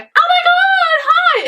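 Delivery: high, slow, gushing "Oh my God, hi!"; mock an overexcited fan squealing hello; gushing fangirl. Mocking how they did not greet the influencer, gushing high squeal. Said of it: "like, going".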